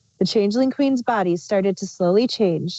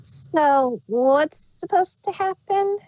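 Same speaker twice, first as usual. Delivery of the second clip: higher, slow puzzled voice that is not Chrysalis; wrong character voice; 8 semitones higher, much slower than usual. Acted. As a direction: ask it in the wrong figure's high tone. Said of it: "oh, that's Chrysalis's voice".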